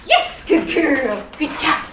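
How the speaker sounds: loud, drawn-out praise for a dog; really excited praise. Real, not acted. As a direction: reward the dog with an excited yes. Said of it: "that really excited yes sound".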